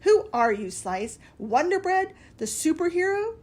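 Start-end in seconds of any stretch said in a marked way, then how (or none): 1.4-3.4 teasing. A narrator's reading. Salt teasing Slice.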